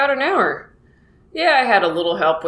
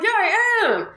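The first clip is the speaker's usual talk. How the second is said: very high-pitched mock protest; hammed-up indignant comeback; insistent retort. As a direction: argue back in a squeaky re-enacted voice. Acted quarrel with brother-in-law.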